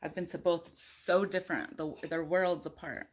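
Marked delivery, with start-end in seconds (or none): none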